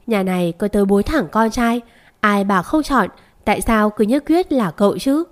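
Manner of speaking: ordinary delivery, nothing marked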